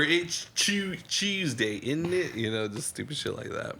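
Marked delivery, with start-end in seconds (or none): 0.0-2.3 put-on meme British accent.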